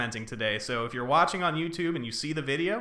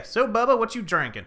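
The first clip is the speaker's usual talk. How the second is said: hammed-up Southern call, louder and higher on Bubba; put-on Southern accent; 6 semitones higher, 5 dB louder than usual. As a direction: hail your buddy like a country boy. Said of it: "it's so bad. I can do that so well".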